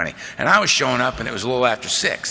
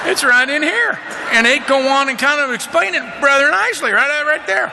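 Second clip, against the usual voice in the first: much higher, louder excited voice; high, loud, thrilled to find it in the book; excited discovery; 3 dB louder than usual. Retold or imitated. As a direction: call out like you just found it. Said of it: "one of us would go".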